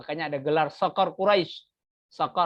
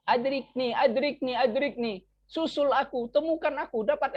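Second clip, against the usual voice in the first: high, insistent mock plea; cry for help; 8 semitones higher than usual. Shown as a demonstration. Teacher showing how Arabs ask for help, acted plea. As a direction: pretend to be lost and calling out. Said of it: "kalau meminta tolong".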